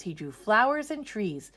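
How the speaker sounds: plain reading voice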